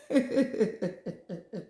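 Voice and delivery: airy high laugh with no voice, falling away; breathy high laugh. Real, not acted. Reacting to a viewer's comment, real laughter. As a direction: burst out laughing at the joke.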